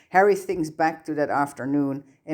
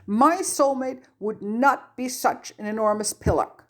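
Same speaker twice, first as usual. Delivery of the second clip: furious tearful outburst; 8 semitones higher than usual. A narrator's reading. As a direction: spit the insult out while still sobbing. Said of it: "wipes furiously at the tears".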